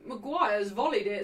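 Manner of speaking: ordinary delivery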